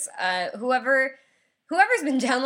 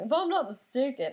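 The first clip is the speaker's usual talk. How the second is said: higher-pitched mock Southern accent; 6 semitones higher than usual. Teller mimicking the talkative bus passenger, mock Southern accent. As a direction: mimic him in a put-on Southern voice. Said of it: "like, a Southern accent".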